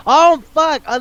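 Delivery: high, startled outburst; sudden realization. Real, not acted. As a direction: blurt it as it suddenly hits you. Sudden remembering mid-sentence.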